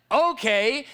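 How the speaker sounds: mock eager okay. Imagined eager acceptance.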